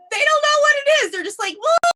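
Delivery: high, loud exasperated shrug. Retold or imitated. Retelling the doctors' shrug, high and loud. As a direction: throw it up high in disbelief.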